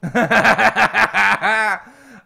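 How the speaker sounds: big surprised laugh; loud burst of laughter. Real, not acted. Reacts to Pugs's confession.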